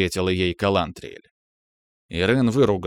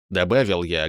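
plain reading voice

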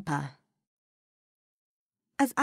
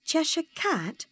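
shy; 12 semitones higher than usual. Acted. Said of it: "she said shyly".

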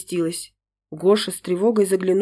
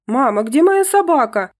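put-on child's shout, higher and louder; yelling; 6 semitones higher, 4 dB louder than usual. Angelinka yelling to mother about her dog. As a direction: yell for the lost dog. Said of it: "заорала Ангелинка".

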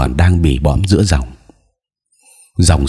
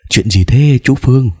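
high-pitched, startled question; narrated mother's anxious query; alarmed; 7 semitones higher than usual. A narrator's reading. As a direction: ask in sudden worry, pitch jumping up. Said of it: "Mẹ tôi hốt hoảng hỏi".

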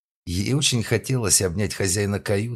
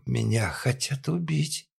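put-on drunk woman voice; 7 semitones higher, slower than usual.

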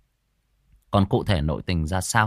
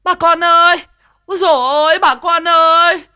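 far higher, far louder yelling for help; deafening shout for help; 13 dB louder than usual. Acted. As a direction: bellow it to wake the whole village. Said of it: "hô váng cả trời".

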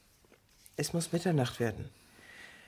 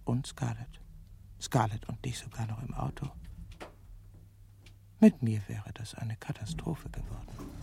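hushed reflective narration, dipping into whispers; inner monologue; 3 semitones lower than usual. Acted. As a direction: speak to yourself in private thought.

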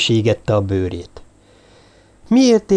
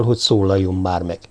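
ordinary delivery, nothing marked

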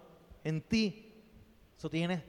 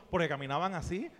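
ordinary talk

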